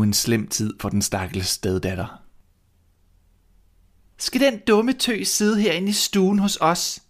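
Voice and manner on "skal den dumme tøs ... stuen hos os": put-on high, sneering spoiled girl; mean stepsister voice. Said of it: "sagde de onde piger". (høj, hånlig stemme som en ond stedsøster)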